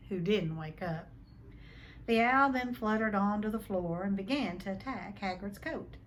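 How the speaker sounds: ordinary delivery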